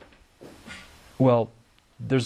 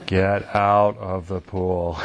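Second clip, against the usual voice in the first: slow, booming call across the pool; a lightly voiced camp counselor shouting to kids; much slower than usual. Shown as a demonstration. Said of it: "my camp counselor voice".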